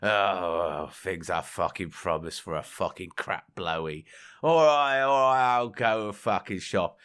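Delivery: low, slow grumbling lout voice; lazy husband. Retold or imitated. Joke husband grumbling at his wife, low and slow. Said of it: "And the husband goes".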